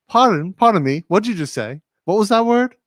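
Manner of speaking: high, lilting mock London accent; proper London accent. British friend questioning his word over lunch, proper London accent. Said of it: "in her, like, proper London accent".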